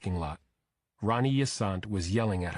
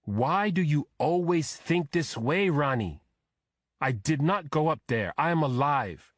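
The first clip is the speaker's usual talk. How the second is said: exasperated husband; 6 semitones higher, 4 dB louder than usual. A narrator's reading. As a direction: push back hard at her, voice raised. Husband argues back with wife.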